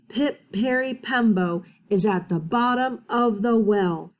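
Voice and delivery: slow and careful, each part of the name spaced out; very slowly. Acted. Chang bows and tells his mother, very slowly. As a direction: spell the long name out with great care. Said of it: "very slowly said".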